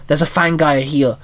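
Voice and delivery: ordinary delivery, nothing marked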